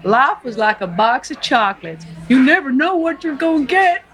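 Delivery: put-on earnest Forrest Gump in a Southern accent. Forrest telling the nurse about his mama, Southern accent. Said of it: "Do the accent".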